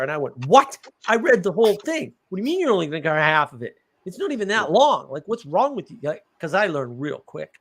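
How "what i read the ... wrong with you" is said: higher, indignant retort as a retold quote; angry outburst. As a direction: re-enact an outraged student challenging the teacher. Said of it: "Man, was I angry".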